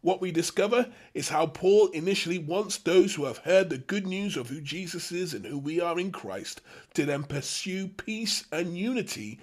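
normal talking voice

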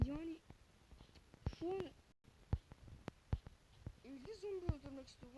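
normal talking voice

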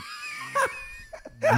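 schaterend gillend lachen; high shrieking laugh. Real, not acted.

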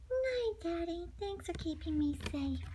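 sleepy thanks before sleep; soft goodnight. Acted. Little bunny saying night to dad, very soft.

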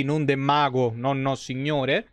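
ordinary delivery

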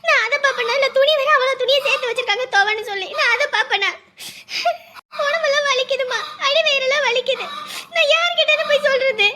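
high, teary, half-sobbing complaint; tearful complaint. Acted. Pregnant daughter crying to her mother on the phone, tearful and high. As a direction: voice breaking between sobs as she lists her pains.

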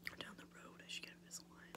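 quick, very soft whisper; hushed, hurried restatement; whispered aside. Real, not acted. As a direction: whisper the correction quickly to yourself. Repeats the line as whispered aside.

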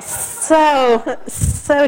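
high, loud laughing disbelief. Real, not acted. Pilgrim mocking her own impatience in the cave, laughing. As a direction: laugh at yourself mid-sentence.

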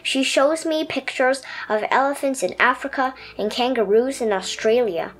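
put-on gentle read-aloud for children; lively storybook narration for kids. A narrator's reading. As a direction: tell it like a bedtime picture book. Reads picture book aloud.

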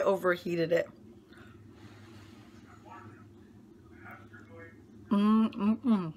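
humming while chewing; chewing lasagna. Real, not acted. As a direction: mouth full of food. Seen 0: Just took a forkful; closed mouth chewing during the "mm" sounds.

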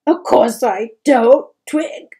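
loud, tearful outburst; sobbing. A narrator's reading. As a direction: cry it out to her son. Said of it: "she sobbed".

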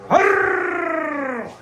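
long, high mock roar; monster cry. Comic votes for monsters on stage, roaring. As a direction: let out a drawn-out comic monster "arrgh". Growls after voting for monsters.

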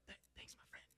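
very soft, barely voiced aside; hushed thanks. Stuck man thanking his helpful friend, hushed. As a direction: murmur thanks under the breath.

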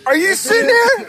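high laughing yell; laughing shout. Real, not acted.

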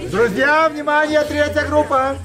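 high loud announcement; announcing. Real, not acted. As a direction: call the room to attention. Announcing to audience. (громкое объявление)

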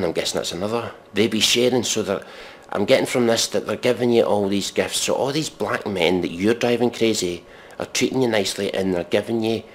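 ordinary delivery

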